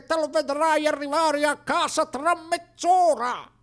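chirpy reassuring friend on the phone; imitating Alcibiade. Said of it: "disse Alcibiade".

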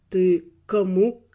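slow, low, incredulous question; puzzled double-check. A narrator's reading. Raymond checking whom she is jealous of, slow and puzzled. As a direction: ask it slowly, pitched well down. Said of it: "переспросил Раймонд".

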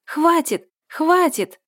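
very high, loud desperate scream; frightened sister shrieking stop. A narrator's reading. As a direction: shriek it to make them stop. Liza stopping the fight.

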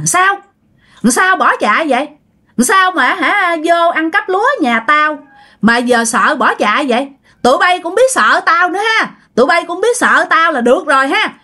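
high, eerie ghost voice sneering; taunting ghost. Acted. Ghost of bà Hai sneering at the fleeing thieves, high and eerie. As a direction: cut them off with a high, spooky sneer.

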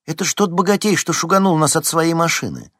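eager loud recognition; excited exclaim. A narrator's reading. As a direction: point out the rich man with indignation. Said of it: "воскликнула Лариса".

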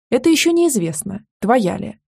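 ordinary delivery

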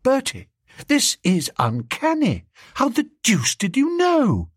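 high-pitched, wondering astonishment; amazed. A narrator's reading. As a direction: climb high on the question in disbelief. Biffy amazed by the known address.

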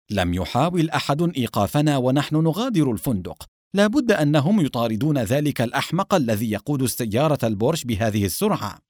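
ordinary delivery

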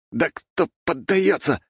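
high, slow, effortful protest; wheezed, halting retort under strain; strained croak. A narrator's reading. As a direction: force it out mid-struggle. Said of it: "прохрипел я".

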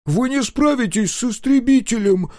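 put-on high, loud desperate child; desperate boy. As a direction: plead like a frightened kid. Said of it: "в отчаянии прошептал Дозер".

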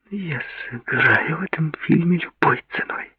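high whispered mutter to herself. A narrator's reading. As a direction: mutter it under your breath. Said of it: "пробормотала она".

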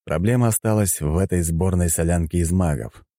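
plain reading voice

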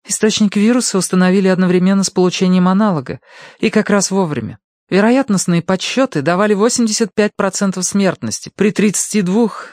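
high, brisk nurse voice; young nurse. Acted. Nurse briefing her patient on the virus, high-pitched. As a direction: report it as a nurse, pitched well up.

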